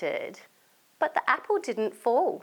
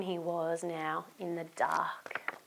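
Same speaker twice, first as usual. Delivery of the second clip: whisper; 5 semitones lower, 10 dB quieter than usual. A narrator's reading. Spooky whispered narration.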